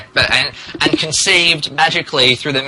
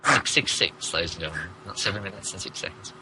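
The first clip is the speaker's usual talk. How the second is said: whispered, low and softer; spooky whisper; 7 semitones lower, 12 dB quieter, much faster than usual. A narrator's reading. Narrator whispers six six six to the listeners. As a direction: breathe it like a sinister secret.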